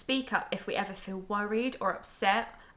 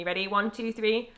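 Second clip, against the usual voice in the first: ordinary talk, nothing marked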